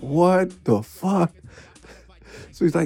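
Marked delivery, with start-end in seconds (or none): 0.0-1.3 slow, drawn-out baffled mock line; bewildered Eli. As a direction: stretch it out like you can't believe it.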